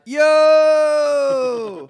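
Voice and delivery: long, loud high holler; drawn-out acted shout; hollered greeting. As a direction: yell it out and hold the vowel. Acts out calling out in ad.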